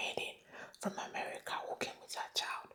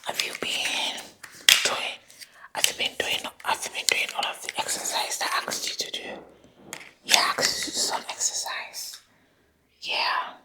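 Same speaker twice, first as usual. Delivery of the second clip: Doctor Quackity Quack; 7 dB louder than usual. Acted. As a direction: play an overeager fake doctor, still whispering. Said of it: "I'm Doctor Quackity Quack".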